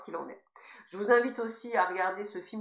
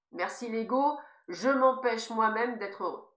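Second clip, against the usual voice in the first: usual speaking voice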